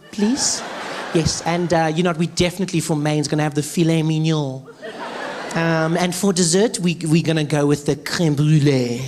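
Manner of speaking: lightly put-on posh French accent when ordering. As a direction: slip into a fancy French accent. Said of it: "change their accent when they order".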